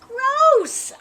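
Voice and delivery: high, loud thrilled gross; gleeful high-pitched cheer; delighted. Acted. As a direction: squeal it with glee. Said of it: "clapping my hands".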